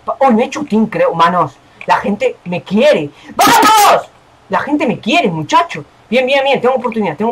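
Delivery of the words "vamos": high, excited cheer; rallying shout. Real, not acted. Player cheered by supporters, rallying shout.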